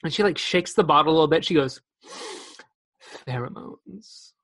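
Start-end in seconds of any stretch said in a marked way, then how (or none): 3.3-4.3 soft, slow sultry murmur; cosmetic model. Retold or imitated. Teacher shaking the bottle like a cosmetic model, sultry. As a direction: draw it out quietly like a sultry ad model. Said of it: "like she's a, like, a cosmetic model".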